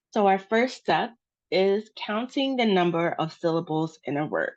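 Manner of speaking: ordinary delivery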